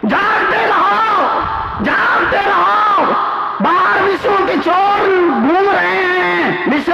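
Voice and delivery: slow, high drawn-out cry; watchman's call. Acted.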